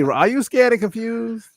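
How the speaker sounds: high, slow mock ad question; half-committed announcer send-up, pitched high; rough commercial parody. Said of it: "gotta do it in the Claude voice".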